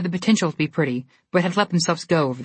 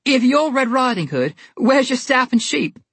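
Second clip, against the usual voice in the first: laughing; 8 semitones higher, 4 dB louder than usual. Acted. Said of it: "laughing as he spoke".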